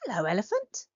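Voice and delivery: usual speaking voice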